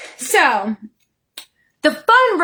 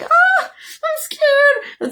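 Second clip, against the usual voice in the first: high, slow, drawn-out mock fright; mock scared squeal; much slower than usual. Mocking a rider on a frightening roller coaster, high pretend fear. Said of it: "it's like".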